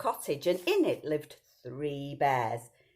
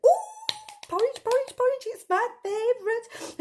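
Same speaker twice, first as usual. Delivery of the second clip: put-on much higher, louder little girl voice; Goldilocks; 6 dB louder than usual. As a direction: squeal it like a greedy little girl. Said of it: "and she went".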